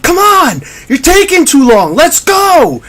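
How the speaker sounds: high, urgent player's yell; imitated screaming. Player to slow emergency personnel, imitated screaming. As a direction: yell like an impatient player, pitch way up. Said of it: "Like, literally screaming".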